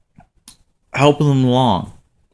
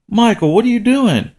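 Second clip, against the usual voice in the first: high-pitched disbelieving question; retold dad's alarmed surprise; startled questioning; 12 semitones higher than usual. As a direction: catch your kid red-handed. Father catches son pocketing fish.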